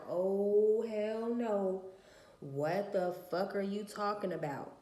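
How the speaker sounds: loud, indignant "oh hell no"; outraged retort. Retold or imitated. Mother retelling her furious reply to the other mom's text, loud and indignant. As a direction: blast back at the insult.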